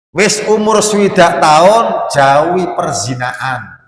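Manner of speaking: loud, high and slow sermon call; preaching at full force. Acted. Preacher warning the elderly against adultery, loud and slow. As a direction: stretch the warning out over the crowd.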